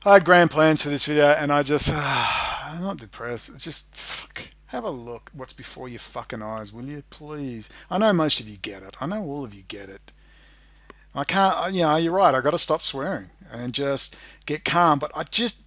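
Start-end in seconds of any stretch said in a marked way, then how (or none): 0.0-10.1 deflated. Real, not acted. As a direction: sigh and sag, worn down. Deflated sigh, denies being depressed.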